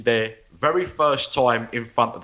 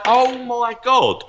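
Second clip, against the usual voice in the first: much higher, slowed mock amazement; amazed; 4 dB louder, slower than usual. Caller stunned by the psychic's reading, amazed and high. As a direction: draw it out high, like you can't believe it. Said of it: "He was like".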